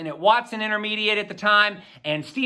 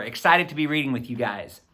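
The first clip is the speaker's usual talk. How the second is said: usual speaking voice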